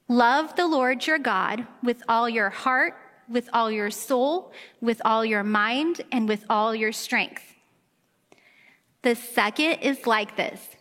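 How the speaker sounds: slow, measured reading in unison; group scripture reading. Real, not acted. Congregation reads the verse together, slow unison recitation. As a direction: read it aloud together, deliberately. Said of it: "I want us all to read it together".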